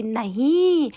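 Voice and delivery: lightly voiced child, drawn-out words; little girl's protest, imitated. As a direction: imitate a small girl insisting, slowly. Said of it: "तो बोल रही है कि".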